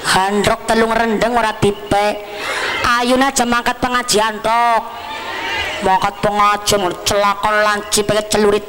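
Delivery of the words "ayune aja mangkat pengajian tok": normal talking voice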